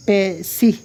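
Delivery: "পেশি" sounded pe-see, ending in "ee"; zer vowel demo. Shown as a demonstration. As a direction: try "পেশি" with an i vowel after the s. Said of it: "সিনেজের, এটা একরকম".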